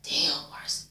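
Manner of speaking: hushed gasp of "Damn, Marcy"; shocked whisper at the screen. Retold or imitated. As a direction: whisper it like a secret. Reacting to being watched.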